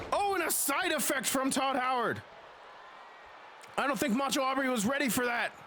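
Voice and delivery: lively ringside play-by-play; wrestling commentator. Acted. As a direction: call the match blow by blow.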